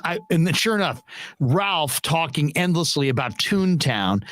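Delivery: usual speaking voice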